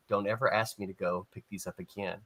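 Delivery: low, hushed warning; imitating a rattled coworker under his breath; shaken. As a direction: say it quietly, deep down and dead serious.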